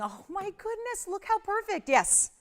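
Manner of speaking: very high, thrilled exclamation; gushing high-pitched excitement; real delight. Real, not acted. Thrilled reaction during a sale.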